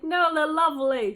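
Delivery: high, loud mock-indignant defence; retold gushing objection; protesting. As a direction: stick up for them, imitating your own protest. Retold protest at being called cheesy.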